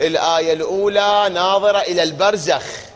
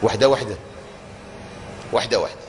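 imitated listener; 11 semitones lower, 7 dB quieter, faster than usual. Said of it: "تقول لي".

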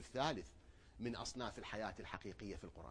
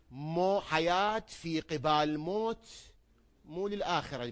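loud, slow, driving sermon emphasis; emphatic preaching; 5 semitones higher, 11 dB louder, much slower than usual. Acted. Preacher insisting to the crowd, loud and slow. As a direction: hammer the point home.

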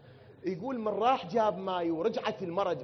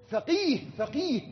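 higher, louder cry of فقيه; exclaiming; 11 semitones higher, 5 dB louder, slower than usual. Real, not acted. Preacher exclaims about a jurist. (صيحة عالية بكلمة فقيه)

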